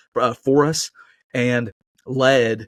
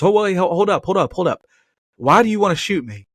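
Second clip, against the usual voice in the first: higher pleading bear voice, a bit hammed-up; voiced bear; 7 semitones higher than usual. Pastor voicing the bear begging not to be shot, high and hammed-up. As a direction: play the startled bear begging the hunter.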